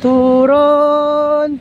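normal talking voice

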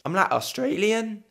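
mock Australian uptalk; Australian accent. Shown as a demonstration. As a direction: try an Aussie accent with rising phrase ends. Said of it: "They go up at the end".

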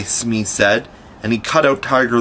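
usual speaking voice

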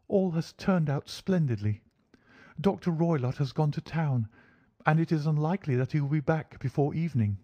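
high-pitched, pleased feminine voice; lady client voice, pitched well up; eager lady client. Acted. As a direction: share good news in a high lady's voice. Client reports good news in character.

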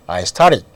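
started with the middle T softened into a flap; flapped T. Shown as a demonstration. Teacher showing how T between vowels changes, flapped pronunciation. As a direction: say started like "star-rid", the T tapped lightly. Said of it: "니을 가까이 발음이 될 수 있죠".

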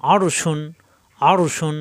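শুন opening on a full sh; hushing sh. Shown as a demonstration. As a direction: give শুন a clear hushing start. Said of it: "শা শা আওয়াজ হবে". (শুন-এ শা শা আওয়াজ)

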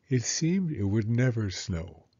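plain reading voice

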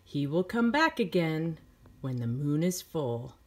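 plain reading voice

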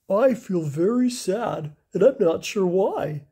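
slow, mildly wailing orangutan voice; a sad ape pouring out his gloom. Acted. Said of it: "wailed the orangutan".

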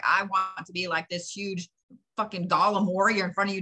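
normal talking voice

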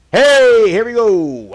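loud, high-pitched gamer cheer; big rising-energy yell; excited shout. Real, not acted. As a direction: holler it out in triumph. Game door opens, excited shout.

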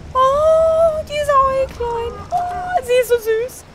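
very high, slow cooing; adoring gushing. Real, not acted. Player admires the small creature, adoring gushing. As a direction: gush over something tiny and cute.

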